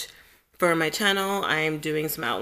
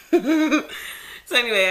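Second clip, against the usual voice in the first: laughing; 4 semitones higher, 4 dB louder, much slower than usual. Real, not acted. Laughing while trying to close.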